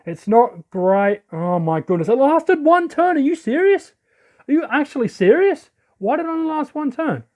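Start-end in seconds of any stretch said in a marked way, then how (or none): 2.1-7.2 high, incredulous complaint; real disbelief. Real, not acted. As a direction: protest in indignant disbelief, pitch up. Game move fails after one turn.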